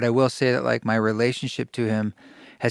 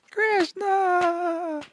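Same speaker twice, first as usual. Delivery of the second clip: "Krishna" sung out much higher through laughter; high playful laughing "Krishna"; laughing delight. Retold or imitated. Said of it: "And he's just laughing".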